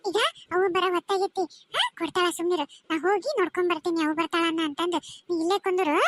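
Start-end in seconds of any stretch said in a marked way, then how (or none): none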